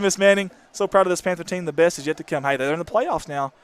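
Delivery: ordinary delivery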